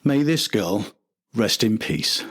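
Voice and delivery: usual speaking voice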